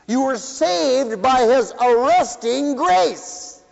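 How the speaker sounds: rising, slow preacher's cry; preaching. Acted. Preacher to his congregation, fervent slow preaching. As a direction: drive it home to the congregation.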